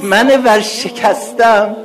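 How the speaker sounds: begging desperately for help, voice breaking upward; weeping supplicant's prayer; tearful plea. Acted. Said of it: "چی بگی؟".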